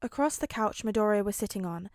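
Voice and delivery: plain reading voice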